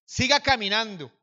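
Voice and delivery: loud, forceful command to keep moving; retold robber's order. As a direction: order him onward, raising your voice. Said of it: "él me dijo".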